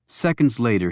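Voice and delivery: usual speaking voice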